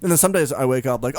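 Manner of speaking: normal talking voice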